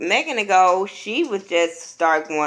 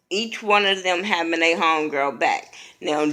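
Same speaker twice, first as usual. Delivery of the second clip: ordinary talk, nothing marked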